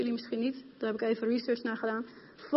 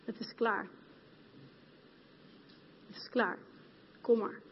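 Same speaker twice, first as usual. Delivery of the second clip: hushed voice of Jesus; 2 semitones lower, 9 dB quieter than usual. Retold or imitated. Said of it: "En Jezus zei op dat moment tegen mij persoonlijk".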